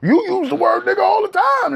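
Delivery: high mocking whiny voice of his critics; sneering high imitation of the commenters. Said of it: "They wanna say".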